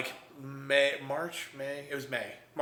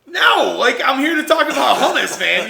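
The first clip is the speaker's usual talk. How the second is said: loud, high outburst; comic indignation, voice right up; exasperated protest; 9 semitones higher, 9 dB louder than usual. Retold or imitated. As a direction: act out frustrated correcting. Retold exasperated reply.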